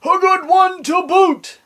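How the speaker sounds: very high, slow, loud crew voice; hammed-up crew chorus; pirate crew agreeing. A narrator's reading. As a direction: echo it like a rowdy chorus of pirates. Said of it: "the others agreed".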